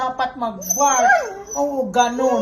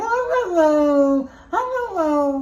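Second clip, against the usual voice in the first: high, squeaky little yips; mocking a weak bark; 5 semitones higher than usual. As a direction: mimic a puny puppy bark. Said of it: "Ganyan yung bark mo". (mataas at maliit na tahol, panunukso)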